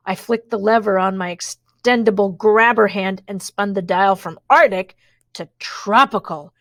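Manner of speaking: plain reading voice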